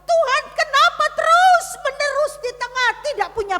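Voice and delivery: loud, high cry to the pastor; retold outcry. Friend crying out to his pastor about God, loud and high. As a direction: cry out the question at the top of the voice. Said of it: "Dia berteriak kepada saya".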